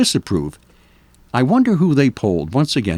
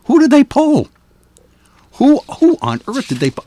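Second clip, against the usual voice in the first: incredulous; 13 semitones higher, 4 dB louder than usual. Real, not acted. Host doubting the poll.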